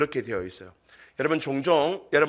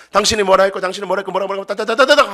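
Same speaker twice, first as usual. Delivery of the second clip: high, loud mimicked tirade; rapid-fire nagging; 6 semitones higher, 6 dB louder, faster than usual. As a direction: rattle off accusations into mere noise. Mimicking spouse's rapid-fire complaints. (따다다 쏘아대는 잔소리 흉내)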